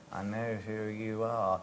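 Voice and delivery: soft, slow hushed line; mock whisper. Storyteller quotes Cameron's hushed greeting, softly. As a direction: lean in and murmur it. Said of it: "whispered into my ear".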